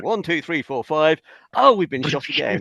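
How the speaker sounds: mock player voice. Telling friends how two players froze together, lightly mocking. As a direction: count it off, then mock dismay. Retold players counting out freeze.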